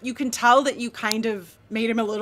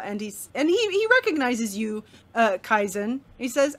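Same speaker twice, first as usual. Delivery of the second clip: ordinary talk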